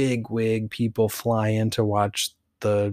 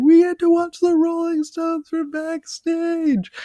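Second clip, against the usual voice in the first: mock tearful squeal, sky-high; crying and excited; 4 dB louder than usual. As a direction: squeal it like ecstatic fans, hammed up. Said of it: "crying and all excited".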